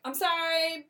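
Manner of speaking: apologetic. Retold or imitated. Apologetic husband.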